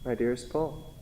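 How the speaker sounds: soft, hushed reading of a dead wife's letter. Acted. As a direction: read her last words gently, barely holding together. Paul reads wife's letter.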